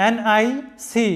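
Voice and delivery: NIC spelled letter by letter, very slowly; slow letter-by-letter spelling; spelling aloud. Shown as a demonstration. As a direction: drag out each letter of NIC. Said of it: "spelling letters for pronunciation".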